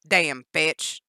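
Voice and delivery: loud angry yell; yelled curse. Acted. Danny yelling at Jennifer over her shoe. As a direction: spit the curse out. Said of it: "Danny yelled".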